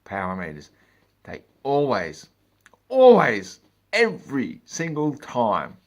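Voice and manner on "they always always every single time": "always" punched high and loud, slowed; slow hammering emphasis; emphatic rant. Real, not acted. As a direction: drag out "every single time" in exasperation. Ranting emphasis.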